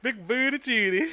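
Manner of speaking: mocking echo.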